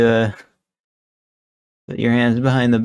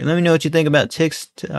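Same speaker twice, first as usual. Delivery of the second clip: ordinary delivery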